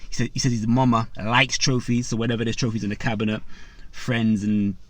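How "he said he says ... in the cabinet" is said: usual speaking voice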